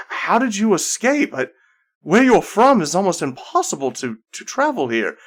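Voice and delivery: stunned they got out; amazed. Acted. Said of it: "And Ceridwen says".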